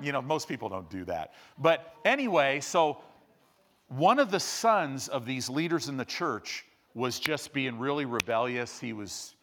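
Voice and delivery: ordinary talk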